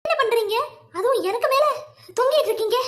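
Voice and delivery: shocked half-laughing protest; startled outcry. Acted. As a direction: recoil from the stranger above you. Startled to find man hanging.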